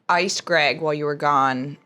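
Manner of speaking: ordinary talk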